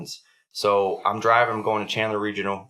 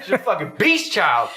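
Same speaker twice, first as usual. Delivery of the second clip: high, slow, drawn-out boast; proud hype; 8 semitones higher, 4 dB louder than usual. Retold or imitated. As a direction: hype up your kid, stretching the big words. Proud dad hyping up his baby.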